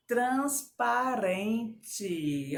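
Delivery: very slow "Transparente", each syllable stretched; syllable-by-syllable dictation. Shown as a demonstration. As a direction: draw out "Transparente" in four separate syllables. Teacher divides word into syllables.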